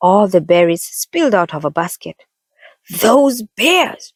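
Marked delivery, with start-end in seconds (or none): 3.1-4.1 exasperated cry, higher pitch; girl complaining loudly about the bears; loud complaint. A narrator's reading. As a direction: vent at the bears in annoyance. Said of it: "she complained loudly".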